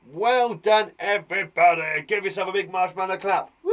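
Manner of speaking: loud, bright praise for the whole class; cheerful praise. Real, not acted. Teacher praising class warmly.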